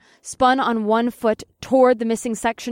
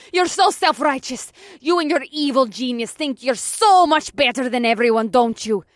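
high, raised-voice accusation; angry shriek; 5 semitones higher, 4 dB louder than usual. A narrator's reading. Villain rails at the hero from the steeple, angry shrieking. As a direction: hurl it bitterly at your rival. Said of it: "Killer Kitten shrieked from her perch".

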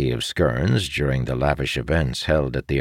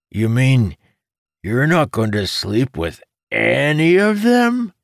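incredulous; 10 semitones higher, 5 dB louder than usual. Acted. Jacob questioning Amandal about sleeping around, incredulous. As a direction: voice climbing high in disbelief.

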